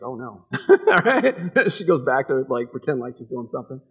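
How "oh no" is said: caught-out oh no. Retold or imitated. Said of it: "she's like".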